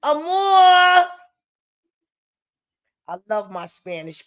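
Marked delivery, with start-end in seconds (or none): 0.0-1.1 higher, loud long shouted call; shouting a name. Real, not acted. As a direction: shout Amor across the house. Calling child's name loudly.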